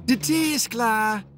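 high, slow call from the kitchen door. A narrator's reading. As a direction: call the tea is ready across the yard. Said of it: "riep Dora vanuit de keukendeur". (roepend vanuit de keukendeur)

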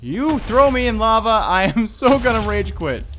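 loud, high outraged shout with a laugh; furious gamer rage with a laugh; outraged yell. Real, not acted. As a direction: yell at the boss for the lava. Thrown in lava by boss.

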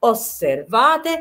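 Osservate drawn out very slowly, syllable by syllable; teacher models Osservate slowly; slow Italian model word. Shown as a demonstration. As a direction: say Osservate slowly as os-ser-va-te for learners. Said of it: "لاحظوا".

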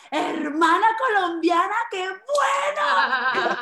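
loud, high, drawn-out squeal of delight; thrilled, belted out, stretched at the end; overjoyed greeting. Retold or imitated. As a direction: gush as if meeting a long-lost compatriot. Gushing at supposed fellow Colombian.

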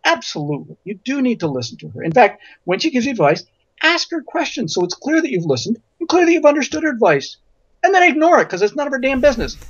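sweetly reasonable, rising to a high-pitched payoff; very nice advice. Retold or imitated. Columnist advising someone about mother-in-law, nicely but cheekily. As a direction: start gently, then climb into a brisk dismissal. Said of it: "wrote back very nicely".

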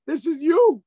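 loud, high insistence; loud, high declaration; emphatic. Retold or imitated. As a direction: drive it home to the boy. Rebbe confronts student.

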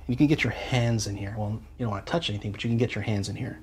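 usual speaking voice

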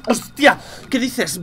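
loud, high shocked outburst; startled shout. Real, not acted. Gamer startled by a sudden event, loud surprise. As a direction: blurt it out at the shock.

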